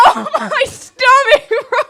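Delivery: high, loud, drawn-out moan; mock tearful wail. Imitating friend almost in tears from stomach pain, wailing. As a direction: wail it slowly, laughter underneath. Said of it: "He's, like, almost in tears".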